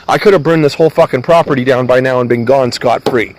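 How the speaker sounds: ordinary talk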